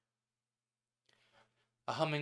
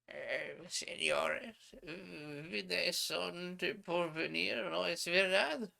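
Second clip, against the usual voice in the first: put-on old innkeeper; 7 semitones higher, slower than usual. Old hotel keeper voiced in Spanish.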